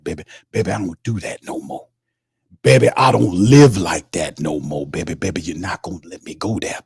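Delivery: smooth-talking reverend spinning a tale; preacher Reverend Saint voice. Acted. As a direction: play the smooth old reverend. Playing the Rev preacher.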